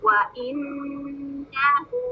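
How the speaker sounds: व इन्नहु very slow, ghunna held two counts, हु stretched; slow tajweed recitation. Shown as a demonstration. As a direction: chant व इन्नहु with rounded व. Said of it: "इस छोटे से word में कितनी सारी चीजों का ख्याल रखना है".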